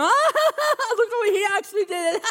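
high, mocking laughter. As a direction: cackle at him like the crowd. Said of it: "I bet you they're all looking".

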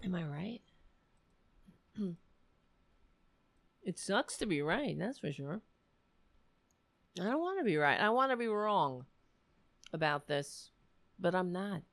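hoarse, fading voice; a worn-out voice going raspy and quiet; raspy whisper. Real, not acted. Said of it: "Sorry, I'm losing my voice".

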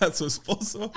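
high laugh into words. Real, not acted. Laughing at mock sermon.

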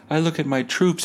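ordinary talk

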